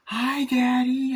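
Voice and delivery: put-on high, drawn-out little kid voice; child's phone greeting. Child phoning their father, high stretched-out mock kid voice. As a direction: imitate a child singing out a slow hello.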